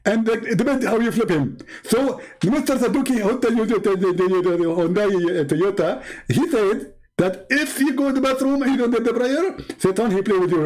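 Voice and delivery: a smug preacher figure explaining his proof; mock Zakir Naik. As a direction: lecture the caller with mock confidence.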